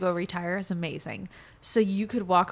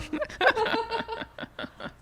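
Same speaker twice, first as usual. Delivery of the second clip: a giggle pitched up; high laugh; 14 semitones higher than usual. Real, not acted. Laughing at her noisy pet bird, high giggle.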